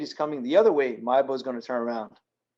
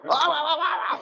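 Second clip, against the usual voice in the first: much higher, stammering foreign captain; imitating ship captain. Container ship captain fumbles on the radio, high stammer. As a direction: mimic a lost captain stuck for words.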